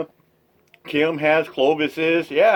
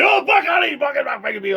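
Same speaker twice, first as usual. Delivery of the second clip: mock rage; 10 semitones higher, faster than usual. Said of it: "He's always raging, yelling".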